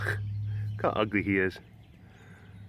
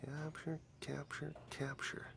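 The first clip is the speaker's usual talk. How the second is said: whisper; 3 semitones higher, 20 dB quieter, slower than usual. Real, not acted. Whispering while filming a cat.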